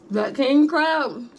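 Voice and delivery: king crab said slow, high and loud; stress on king crab. Real, not acted. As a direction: stretch out "that king crab" loudly. Emphatic drawn-out exclamation.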